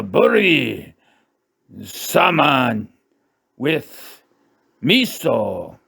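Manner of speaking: slow, hammed-up Japanese accent; mock Japanese accent. Comic reading the last salmon dish, mock Japanese accent. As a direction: announce the dish word by word in fake Japanese accent.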